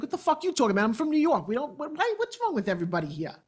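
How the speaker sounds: high-pitched, sputtering protest, dropping at the end; retold baffled indignation. New York kid answering the teasing locals, mock indignant.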